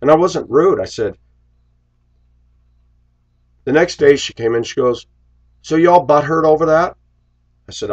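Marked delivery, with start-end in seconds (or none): 5.6-7.0 high, louder mocking jab at the coworker. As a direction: needle him like the rude coworker. Coworker mocked in retold quarrel.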